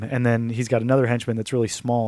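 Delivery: ordinary talk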